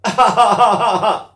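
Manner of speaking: loud, high staccato laugh; imitated baby laugh. Shown as a demonstration. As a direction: demonstrate a burst of "ah-ah-ah" laughter. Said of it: "Or they laugh".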